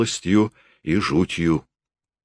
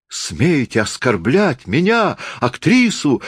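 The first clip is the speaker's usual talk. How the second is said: shout; 7 semitones higher, slower than usual. A narrator's reading. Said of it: "крикнула она".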